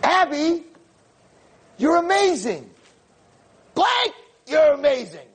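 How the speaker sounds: shower her with excited praise. Retold or imitated. Rabbi surprises the cursing girl with high excited praise. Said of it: "I'm like".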